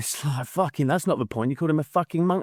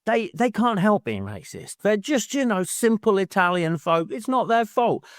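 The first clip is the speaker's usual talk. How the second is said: mock the author's smug tone; a hint of a smug pundit; patronising mock voice; 6 semitones higher than usual. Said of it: "He's like".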